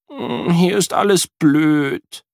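put-on glum boy. A narrator's reading. Sad boy at new home.

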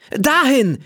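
hoch und aufgeregt gerufen; aufgeregter Ruf. A narrator's reading. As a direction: zeig begeistert auf den Fleck. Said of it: "ruft sie Tommy zu". (aufgeregter Ruf, sehr hoch)

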